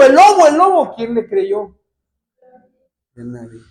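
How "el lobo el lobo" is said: much higher, loud frantic cry; desperate shout. Retold or imitated. Shepherd boy calling for real as the wolf comes, loud desperate cry. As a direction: scream for help, truly afraid this time. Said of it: "grita el niño".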